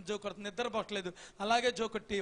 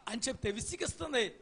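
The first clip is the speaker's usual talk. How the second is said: ordinary talk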